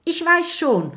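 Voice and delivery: very high, quick call. A narrator's reading. Kokosnuss answers his friend while fleeing, calling out high. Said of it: "ruft Kokosnuss".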